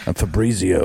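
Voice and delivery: purring latin lover. Pretend. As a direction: drop it low and seductive. Low character voice.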